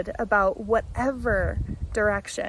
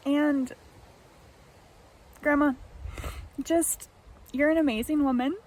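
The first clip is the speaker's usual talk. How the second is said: fighting back tears on a compliment; choked up; 4 semitones higher than usual. Real, not acted. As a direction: voice catching with real emotion, quick. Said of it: "Sorry, I'm getting emotional".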